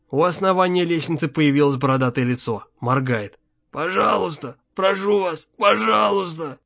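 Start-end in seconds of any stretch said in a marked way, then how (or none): 3.7-6.6 higher, altered begging voice; imploring stranger's voice, pitched up; pleading captive. A narrator's reading. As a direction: plead desperately, drawing out the last word. Captive begs to be released.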